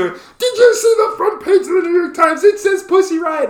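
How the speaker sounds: put-on breathless teen excitement; giddy fifteen-year-old. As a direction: gush like a teenage girl spotting gossip. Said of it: "we were like two fifteen-year-olds".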